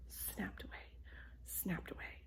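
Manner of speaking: whispering snapped away; whispered. A narrator's reading. Narrator whispers as the cat takes selfies. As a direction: breathe it out softly like camera clicks.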